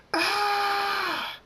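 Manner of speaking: long, very high scream; acted scream. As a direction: hold out a high-pitched "aah". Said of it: "he screams".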